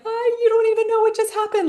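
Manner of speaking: very high, fast chirpy little bird voice. Pretend. Rescued bird telling the world about its escape, squeaky high. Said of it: "and was like".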